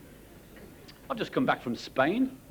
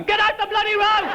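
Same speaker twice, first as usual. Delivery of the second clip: high, loud mock shout through a megaphone; megaphone yell; 13 semitones higher, 4 dB louder than usual. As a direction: bellow it like airport control. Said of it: "a bloke with a megaphone".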